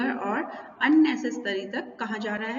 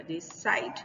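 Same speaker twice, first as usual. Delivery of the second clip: decide with a light "de", then a stretched SIDE; second-syllable stress; 3 semitones lower, 5 dB quieter than usual. Shown as a demonstration. Teacher confirming the rule, decide stressed on SIDE. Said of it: "stress लगा रहे हैं side पर".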